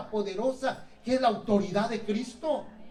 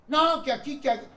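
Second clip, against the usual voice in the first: loud, higher angry protest; acted angry retort; 5 semitones higher, 5 dB louder than usual. A man arguing back on the street, loud and heated. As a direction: snap back at a rival on the street.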